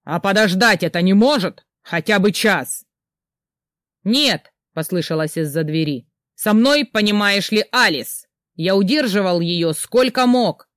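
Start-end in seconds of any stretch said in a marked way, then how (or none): 0.0-2.9 shouting at the door. Acted. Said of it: "Снова громко в сторону двери". 4.0-4.5 higher, louder sharp refusal; shouted no. Acted. Friend shouting from behind door. 6.4-10.7 higher, louder exasperated call; exasperated shout. Acted. Friend shouting from behind door.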